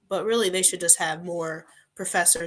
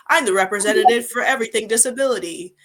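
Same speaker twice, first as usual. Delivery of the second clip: mock grand announcement, higher and louder; self-important mimicry; 3 semitones higher, 7 dB louder than usual. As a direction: mimic someone speaking for everybody. Mimics a self-appointed spokesperson.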